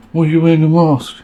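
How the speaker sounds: high, loud, demanding protester voice; a challenging protester shouting out a question; protester impression. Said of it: "That's my impression of a protester there".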